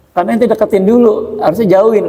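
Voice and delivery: ordinary talk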